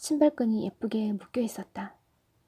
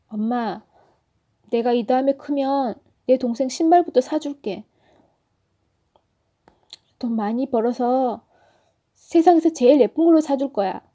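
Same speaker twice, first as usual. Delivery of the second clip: louder, pushing the voice to sound strong; forced brave voice; 2 semitones higher, 7 dB louder than usual. Acted. Boy promises his mother new shoes, forcing a brave loud voice. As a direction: put on a bold front so the tears stay back. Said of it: "씩씩한 척 목소리에 힘을 주고".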